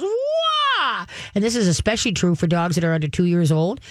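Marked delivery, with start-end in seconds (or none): 0.0-1.1 much higher yelping "wah" of a riled-up dog; excited dog yelp. Retold or imitated. Riled-up dog reacting to gushing guests, high yelp. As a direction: yelp like a hyped puppy. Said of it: "The dog just goes".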